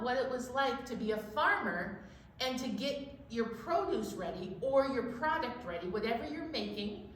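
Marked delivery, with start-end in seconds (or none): none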